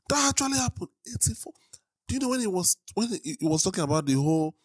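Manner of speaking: high, incredulous questioning; reeling, high-voiced and halting; stunned disbelief. Real, not acted. Stunned by the death toll.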